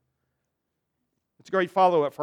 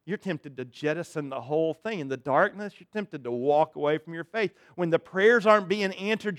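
normal talking voice

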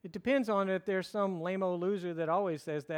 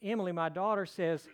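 ordinary talk

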